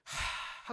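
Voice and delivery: re-enacted discouraged sigh; heavy sigh. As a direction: let all the breath out in a sigh. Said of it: "한숨이 나오더라고요". (힘들어 내쉬는 한숨)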